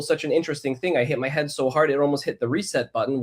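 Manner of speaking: usual speaking voice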